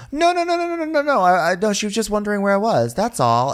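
very high, rapid mock panic; hammed-up scrambling excuse; flustered denial. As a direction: backpedal frantically in a squeaky voice. Caught out, hastily denying it.

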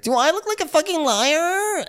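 very high whiny squeal; mock Lindsay. Co-host mocks Lindsay's outburst, very high squeal. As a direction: squeal the question indignantly.

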